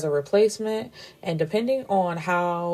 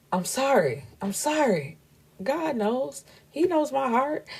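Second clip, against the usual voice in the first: apology climbing higher as it goes; mock pleading apology; 8 semitones higher than usual. Man apologising for swearing in church, high hammed-up pleading. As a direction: plead innocence, rising into a high voice.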